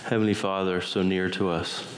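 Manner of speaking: ordinary delivery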